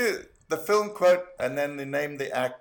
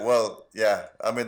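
normal talking voice

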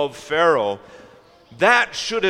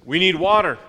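mock-angry demand, a bit louder; 3 semitones higher, 4 dB louder than usual. Israelites demanding water from Moses, mock-angry. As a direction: insist like a thirsty, impatient crowd. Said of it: "the argument kinda went like this".

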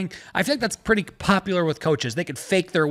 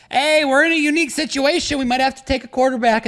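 car salesman voice; 6 semitones higher than usual. Retold or imitated. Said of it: "All these coaches turn into car salesmen".

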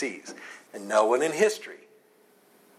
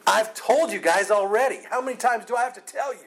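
higher, exasperated retort, rushing at the end; acted-out exasperation, pitched up; irritated, frustrated; 9 semitones higher, 5 dB louder than usual. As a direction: snap it like you're fed up repeating yourself. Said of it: "He's irritated. He's frustrated".

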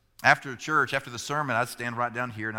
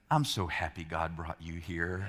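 lower, softer mock sincerity through a laugh; mock-earnest son; 9 semitones lower, 6 dB quieter than usual. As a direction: son teasing his dad with fake churchy praise. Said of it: "he put his hand on my hand and goes".

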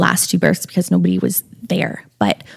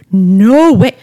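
louder, incredulous retold reaction; mock disbelief; 2 semitones higher, 5 dB louder than usual. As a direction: act out a stunned planner's refusal to believe it. Retold planners' stunned reply to mom.

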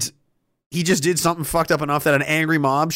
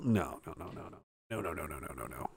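much lower, softer, fading no's; fading mock refusal; 12 semitones lower, 19 dB quieter than usual. Party member going down with the ship, fading away. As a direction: sink away murmuring no.

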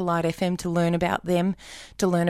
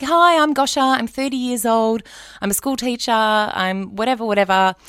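higher, louder, perky self-introduction; audition message; 5 semitones higher, 5 dB louder than usual. Retold or imitated. Leaving a perky audition message on the radio line. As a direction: sell yourself brightly on the answerphone. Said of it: "It was like".

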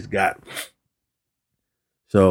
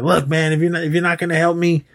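higher-pitched, exasperated mock outburst; hurried, raised-pitch frustration, acted out; retold snapping; 7 semitones higher, faster than usual. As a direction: imitate a student snapping at his mentor. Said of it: "Daniel just snaps on him".

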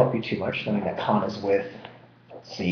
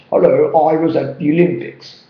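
hammed-up, clumsy British accent; badly faked British accent, a bit louder and higher; 4 semitones higher, 5 dB louder than usual. Shown as a demonstration. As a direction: put on a forced, fake British accent. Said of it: "Very bad British accent".

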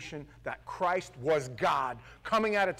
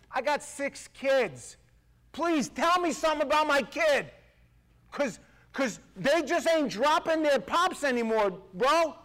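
pitched high and loud, pleading hard; a pastor crying out about his own six kids; preaching at full force; 11 semitones higher, 6 dB louder than usual. Acted. Preacher pleading to parents, high and loud. Preacher builds to impassioned cry.

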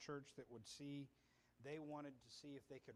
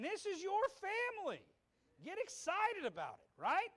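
full-force preaching; 10 semitones higher, 11 dB louder than usual. Real, not acted. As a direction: preach it out at the top of the voice.

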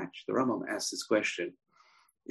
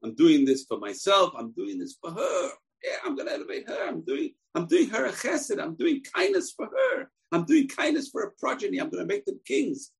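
much higher, pleading voice of a king excusing himself; self-justifying David; 9 semitones higher than usual. Retold or imitated. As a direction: whine out his noble excuses. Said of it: "It wasn't like".

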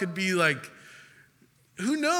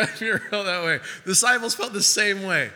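ordinary talk, nothing marked

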